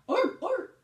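high, loud barking seal sound. Shown as a demonstration. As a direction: bark like a playful seal. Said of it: "That's what they say".

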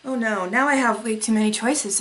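usual speaking voice

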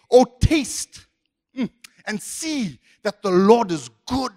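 loud "taste", then slow relish; savouring. Acted. Preacher quoting the psalm about tasting, savouring delight. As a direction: stretch it out with an appreciative "mm".

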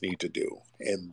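a whispered aside to the caller; whispered jab. Real, not acted. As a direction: drop to a whisper for the dig. Whispered jab inside rant.

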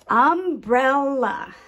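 "Umbrella" high, loud and slow in three beats; tapped syllables. Shown as a demonstration. Teacher presenting a new word, "Umbrella" syllable by syllable. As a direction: announce "Umbrella" as um, brel, la. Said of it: "tap out the different sound groups".

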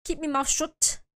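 a hint of an Irish accent; attempted Irish accent. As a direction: try the Irish vowels lightly. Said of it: "I can't do the Irish accent".

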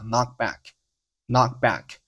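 slow "knock back" with the first k held silent; unreleased k. Shown as a demonstration. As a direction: stop the k of "knock", then release into "back". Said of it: "前面的爆破音要失爆".

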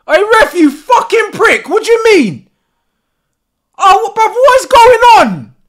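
irate, high, loud protest; angry shout. Real, not acted. Fan raging at the ref over no foul, angry shout. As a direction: yell in disbelief at the ref.

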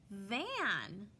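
"Van" said as one whole word; blended word. Shown as a demonstration. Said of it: "What is the word?".

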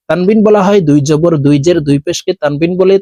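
ordinary talk

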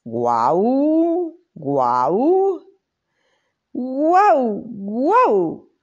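high woofs shown to a pupil, each one falling; barking lesson. Acted. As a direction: demonstrate a proper bark, one woof at a time. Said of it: "se hace así". (ladridos de demostración, agudos y cayendo, guau guau)

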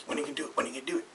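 hushed, nagging imitation of a friend; quiet needling. Friend pesters him about the bet, quiet needling. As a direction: quote her softly, under the breath.

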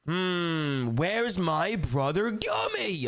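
slow, drawn-out searching call; playful, lingering hide-and-seek call; teasing seeker. Pretend. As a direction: stretch it out to taunt the hider. Seeker teasingly calls the hider.

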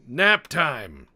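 NAP TIME yelled high and loud; mock triumphant yell. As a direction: belt it out playfully at a downed foe. Repeats it as mock battle cry.

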